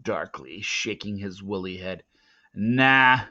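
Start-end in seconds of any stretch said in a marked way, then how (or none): 2.5-3.2 sneer. Acted. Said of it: "he sneered".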